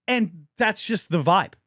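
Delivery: normal talking voice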